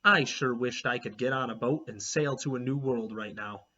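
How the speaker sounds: plain reading voice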